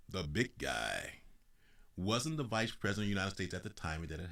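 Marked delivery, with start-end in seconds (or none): 0.1-1.2 much lower, slow sneer; sarcastic. Real, not acted. Speaker mocking the nickname for Biden, low slow sneer. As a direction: drop the voice and drag it out mockingly.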